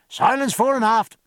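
calling out. A narrator's reading. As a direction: call for silence along the whole deck. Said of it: "called Pullings".

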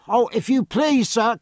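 high, loud plea; high-pitched, urgent appeal to a superior; urgent cry. A narrator's reading. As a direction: cry out for help, pitched right up. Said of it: "cried Jemmy Ducks".

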